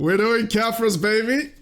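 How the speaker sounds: loud, high, thrilled announcement; excited shout. Real, not acted. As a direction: yell it out in triumph. Host announces favorite character excitedly.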